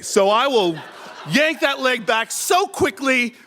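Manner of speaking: ordinary talk, nothing marked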